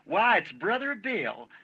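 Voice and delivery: high, slow, drawn-out glad welcome from the mother; mother greets the preacher at her door, sung out high and slow; mother's glad greeting. Retold or imitated. A mother at her door welcoming the preacher, high, slow and glad. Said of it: "like that".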